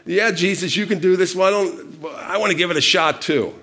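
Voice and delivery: high, excited imagined request; playing someone keen to join in; eager acted plea. Acting out eager wish to join.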